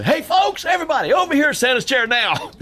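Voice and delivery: high, loud call to the crowd. Retold or imitated. Mother calling the crowd to Santa's chair, loud high call. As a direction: summon everyone over to the chair.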